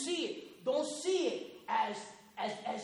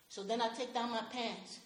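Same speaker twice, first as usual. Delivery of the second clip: ordinary delivery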